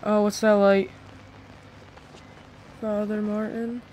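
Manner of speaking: ordinary talk, nothing marked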